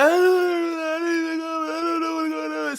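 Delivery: high, drawn-out mock wailing; hysterical wail. Calling his mother in panic, hammed-up hysterical wail. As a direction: wail the words into an unintelligible cry. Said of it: "I was so hysterical".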